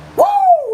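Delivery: very high, loud whoop; piercing playful whoop; falsetto woo. Pretend. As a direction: hoot a falsetto woo that slides down.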